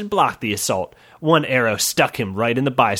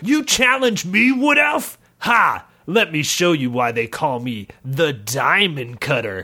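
boastful villain taunt. Acted. Villain taunts wood elf.

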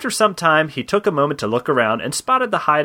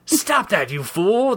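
higher put-on scolding voice; mildly indignant creature voice; right snake head; 5 semitones higher, slower than usual. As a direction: scold as an irritated door knocker. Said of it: "the right head replied".

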